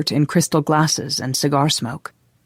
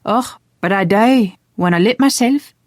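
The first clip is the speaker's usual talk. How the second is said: put-on playful Scottish accent, raised pitch; delighted Scottish lilt; 6 semitones higher, 4 dB louder than usual. A narrator's reading. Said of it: "her eyes showing her delight".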